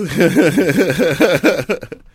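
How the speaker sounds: long, loud, high cackling laugh; big belly laugh. Real, not acted. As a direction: burst out laughing at your own punchline. Laughs at own punchline.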